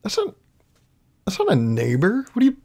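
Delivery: very high, small incredulous squeak; squeaky disbelief. Retold or imitated. Kid to a friend about neighbors, squeaky disbelief.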